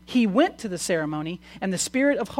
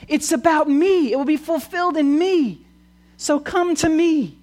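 high, loud proclamation as Jesus; yelling; 9 semitones higher, 5 dB louder than usual. Retold or imitated. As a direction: cry it out over the crowd. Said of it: "It's obnoxious when people yell".

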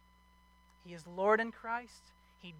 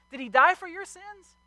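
much higher and louder, pressing the question; urgent preaching question; 12 semitones higher, 12 dB louder than usual. Acted. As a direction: push the question straight at the congregation. Peak of preaching.

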